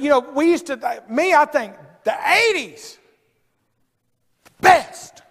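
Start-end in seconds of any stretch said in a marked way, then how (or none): 4.6-5.2 best shouted high and loud; emphatic. Real, not acted. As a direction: hit best like a peak of the sermon. Preacher praising the eighties.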